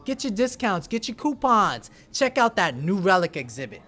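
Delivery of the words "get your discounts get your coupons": usual speaking voice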